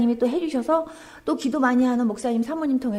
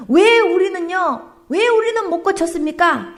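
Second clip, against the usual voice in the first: full-force preaching; 7 semitones higher, 6 dB louder than usual. Acted. Preacher quoting the disciples' question, loud. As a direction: thunder the question at them.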